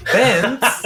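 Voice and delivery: high loud playful call; playful shout. Pretend. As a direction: call the name out. Joking name call.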